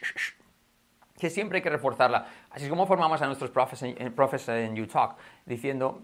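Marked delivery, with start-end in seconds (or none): 0.0-0.3 repeated voiceless hushing "sh"; isolated sh. Shown as a demonstration. Teacher drills the sound, isolated sh. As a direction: push out short bursts of "sh". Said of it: "trabajamos también el sh".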